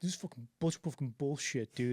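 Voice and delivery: imitated sulky complaint under protest; mock grumble of a foot-dragging actor; reluctant grumbling. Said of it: "literally dragging his feet".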